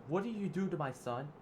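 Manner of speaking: high, loud anxious mother's voice; a mom demanding who hurt her son; mother Alice questioning Edward in alarm; worried mother. Acted. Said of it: "Alice said".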